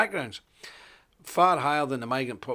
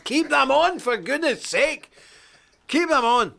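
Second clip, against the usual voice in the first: loud, high and appalled, pleading with the nudists; outraged cry; 10 semitones higher, 5 dB louder than usual. Real, not acted. Appalled at the naked beachgoers, he cries out loudly. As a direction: cry out in disgust for them to cover up.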